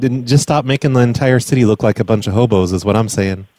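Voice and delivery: usual speaking voice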